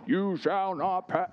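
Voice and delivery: lightly voiced slow wizard boom; a hint of Gandalf blocking the way; Gandalf quote. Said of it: "Lord of the Rings style".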